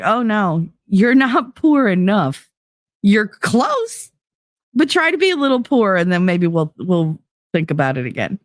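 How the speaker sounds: high-pitched parody of officials turning her down; mock sympathy. Mocks officials refusing her help.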